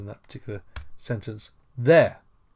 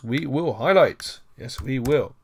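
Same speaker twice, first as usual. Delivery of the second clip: mock strict; 3 semitones higher, 7 dB louder than usual. Shown as a demonstration. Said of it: "by the rules".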